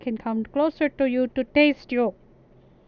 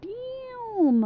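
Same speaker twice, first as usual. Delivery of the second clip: surprised; 12 semitones higher than usual. Real, not acted. Reacts to five hundred dollars.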